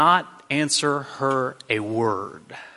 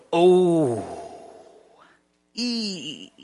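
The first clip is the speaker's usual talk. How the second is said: awkward cringe. Acted. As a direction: wince aloud at an awkward moment. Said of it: "This is the moment where you go".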